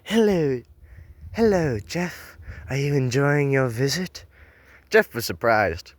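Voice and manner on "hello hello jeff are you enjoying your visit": British gangster. Acted. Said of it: "make Jane the Killer sound like a, like a British gangster".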